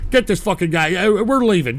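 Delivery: ordinary talk, nothing marked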